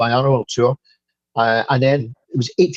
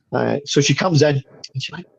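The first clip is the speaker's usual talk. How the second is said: normal talking voice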